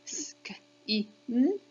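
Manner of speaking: "Sk, I, n" as separate phonemes, short i, held n; sounding out. Shown as a demonstration. Teacher counting the sounds for children, sounding out "Sk, I, n". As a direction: say each sound of "Sk, I, n" on its own. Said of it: "Let's do the sounds".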